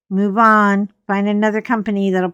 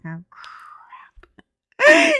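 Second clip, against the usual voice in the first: whispered through a laugh, very high; breathless giggling oh crap; 4 dB louder than usual. Retold or imitated. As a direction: squeak it out while cracking up. Reacting, laughing, under her breath.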